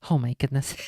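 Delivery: usual speaking voice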